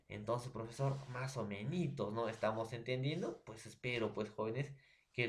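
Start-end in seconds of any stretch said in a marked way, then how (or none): none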